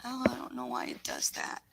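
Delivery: ordinary talk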